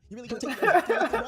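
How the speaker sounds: high, loud, through laughter; cracking up mid-line; laughing. Real, not acted. Vlogger laughing hard while voicing Koda's comeback.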